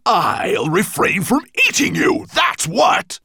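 high, loud threat building to a shout; furious dragon bellow; angry roar. A narrator's reading. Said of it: "Nettlebrand was so angry".

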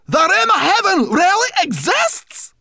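very high, loud amazed cry; excited cry. A narrator's reading. Sorrel cries out at the news, high and loud. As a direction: burst out amazed.

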